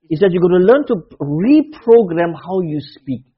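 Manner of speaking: ordinary delivery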